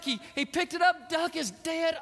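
usual speaking voice

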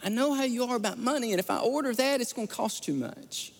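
a hint of a worried nine-year-old; little daughter. Daughter explains to dad at dinner, worried little girl voice. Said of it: "And she said".

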